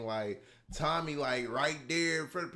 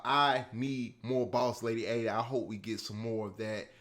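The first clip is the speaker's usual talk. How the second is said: normal talking voice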